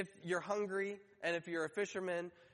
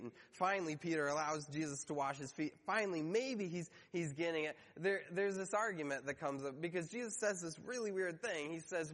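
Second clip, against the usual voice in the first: ordinary delivery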